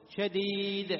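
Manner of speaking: loud recited "شديد" with bounced final d; loud, sustained "شديد", short release; qalqala on dal. Shown as a demonstration. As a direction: hold the ee of "شديد", then echo the d briefly. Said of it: "تخفف شدته بالقلقلة".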